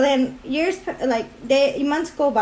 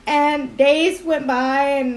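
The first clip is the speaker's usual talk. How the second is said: usual speaking voice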